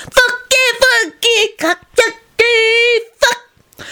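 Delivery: put-on very high, slow, sing-song toddler voice; tiny girl gleefully repeating swear words; small child. A narrator's reading. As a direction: chant it like a playground rhyme.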